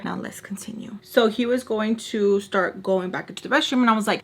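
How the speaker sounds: usual speaking voice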